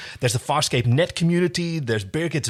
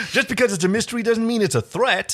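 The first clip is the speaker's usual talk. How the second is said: higher, reasoning with a friend; John voice; 8 semitones higher than usual. Acted. John reasoning with Dargo, lightly raised voice. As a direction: talk him down, higher pitch. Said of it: "We're just gonna do some voices".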